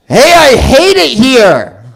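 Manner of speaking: high, slow, loud New York "Hey" yell; New Yorker impression. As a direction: belt it like a cabbie leaning out the window. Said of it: "an ungrateful teenager from New York".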